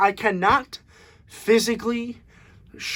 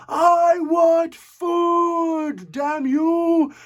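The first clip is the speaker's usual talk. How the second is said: high, long-drawn mock scream; half-held-back scream, stretched out and high; imitated scream; 9 semitones higher, much slower than usual. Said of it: "he literally screamed the entire film".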